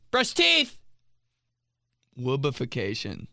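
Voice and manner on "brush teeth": high-pitched mock holler; aunt calling out. Imitating aunt when a commercial comes on, high holler. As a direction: call the order out across the house. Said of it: "Every time a commercial comes on".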